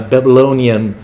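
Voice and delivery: normal talking voice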